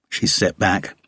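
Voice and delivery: ordinary delivery, nothing marked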